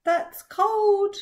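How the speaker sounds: high, loud cry of shock at cold water; shocked gasp. Acted. Grandpa shocked by cold water, gasping high and loud. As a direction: squeal it out like icy water hit you. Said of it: "took a quick breath in and said".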